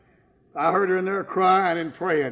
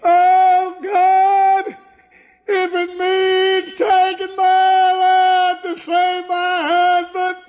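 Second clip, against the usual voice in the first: high, loud, slow anguished cry; wife praying aloud through tears; weeping prayer; 13 semitones higher, 9 dB louder, much slower than usual. Retold or imitated. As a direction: cry out to God in despair. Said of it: "I heard her in there weeping, praying".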